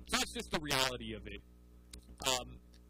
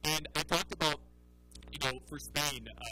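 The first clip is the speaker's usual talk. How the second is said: ordinary talk, nothing marked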